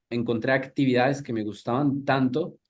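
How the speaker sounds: ordinary delivery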